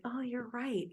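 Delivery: light, hushed, easy concession; untroubled child. Retold or imitated. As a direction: softly imitate a child agreeing. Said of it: "peer correction is not a problem".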